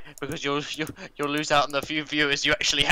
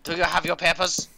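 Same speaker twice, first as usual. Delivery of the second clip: raised, mock German demand; mock German official; 3 semitones higher, 4 dB louder than usual. Streamer demanding papers of co-host, mock German accent.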